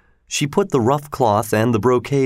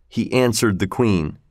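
ordinary delivery

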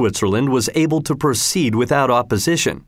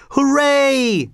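high held cheer; a lightly acted crowd hurrah; 14 semitones higher than usual. A narrator's reading. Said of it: "shouted everybody".

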